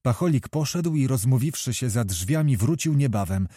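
usual speaking voice

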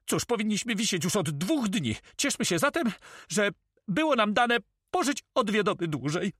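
very high-pitched, animated character voice; high storybook pirate voice; pirate comrade making light of danger. Acted. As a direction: pitch it way up, lively and philosophical.

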